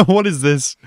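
high, slow laugh-through question; laughing disbelief. Real, not acted. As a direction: laugh while asking in disbelief. Laughing at scene.

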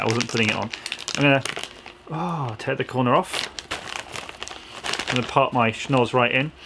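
ordinary delivery

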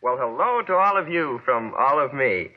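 ordinary talk, nothing marked

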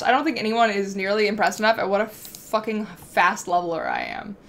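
ordinary talk

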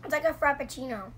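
eating a chocolate piece; chewing while talking. Real, not acted. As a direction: mouth partly full of chocolate. Seen 4: Teal-shirt speaker holds bitten chocolate, mouth working between words.

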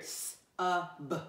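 ordinary talk, nothing marked

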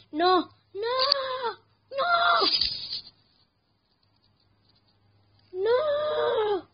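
high, loud drawn-out cries of "no"; anguished wail. Real, not acted. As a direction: wail in despair as the game is lost. Player is eaten in game.

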